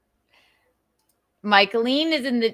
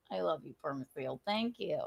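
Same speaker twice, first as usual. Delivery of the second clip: soft thanks; 5 semitones lower, 15 dB quieter than usual. Real, not acted. Quiet thanks to donor.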